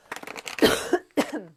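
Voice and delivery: sharp dry cough; real cough mid-sentence; loud cough. Real, not acted. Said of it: "Pardon me for coughing".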